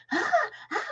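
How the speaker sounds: high squealing ah ah, imitating a scared girl; light mock shrieks; mock scream. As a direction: squeal it like a panicked kid. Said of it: "like screaming at the fish".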